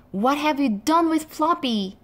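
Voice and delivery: cross yell. A narrator's reading. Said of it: "yelled Biff crossly".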